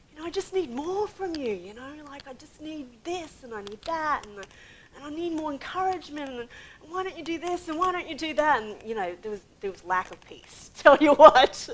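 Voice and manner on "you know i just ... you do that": higher-pitched, needy nagging; nagging complaint. Retold or imitated. Wife nagging her husband for more, mock complaint. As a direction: pile up the demands in a raised, pressing voice. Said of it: "I was going through this phase".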